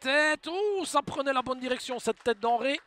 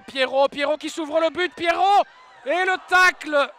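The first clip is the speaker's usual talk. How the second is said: excited sports commentary; 5 semitones higher, 10 dB louder than usual. Real, not acted. Scoring chance, rising shout.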